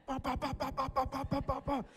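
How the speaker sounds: high, rapid-fire "ba" volley; imitated punch flurry. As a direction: mimic fast punches in a high staccato.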